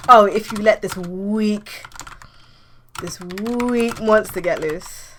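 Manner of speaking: slow, drawn-out taunt; mocking insult. Rival hero insulting Nova, mocking. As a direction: stretch it out scornfully.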